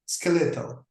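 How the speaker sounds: skeletal with an Italian-sounding accent, ske-LE-tol. Shown as a demonstration. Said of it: "similar to the accent of the Italian people".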